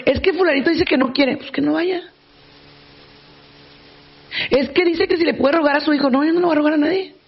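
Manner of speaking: mock exchange. Psychologist mimicking complaints about clients and her replies, dismissive.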